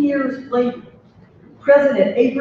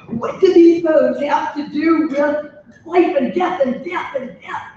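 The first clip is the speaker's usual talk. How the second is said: hammed-up protesting student, shouting the repeated death; mock indignant objection; 5 semitones higher than usual.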